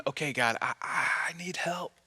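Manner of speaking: soft, stammered prayer sinking to a whisper; acted faltering, hushed prayer; hesitant whispered plea. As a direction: whisper it haltingly, as if barely able to ask.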